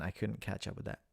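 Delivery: usual speaking voice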